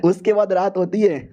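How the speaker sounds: usual speaking voice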